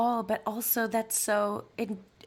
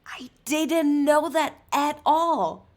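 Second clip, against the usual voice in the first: slow, high, louder disbelief; drawn-out real astonishment; amazed; 6 semitones higher, 7 dB louder, slower than usual. Real, not acted. Still absorbing the lawyer news.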